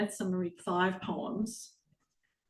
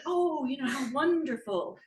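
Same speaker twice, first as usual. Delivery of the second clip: higher, gushing acted reaction; mock delight; 6 semitones higher than usual. Poet imagines viewers charmed by dancing robot dogs, mock delight. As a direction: gush as if naively charmed.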